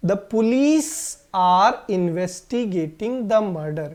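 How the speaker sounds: very slow, word by word; slow model sentence. Shown as a demonstration. Teacher reads the example sentence to the class very slowly. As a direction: dictate it slowly. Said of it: "एक बार दोबारा बोलिए".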